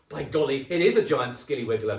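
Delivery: loud, high put-on alarm; loud hammed-up fright; mock-shocked exclamation. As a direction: act scared to frighten her. Husband scaring wife.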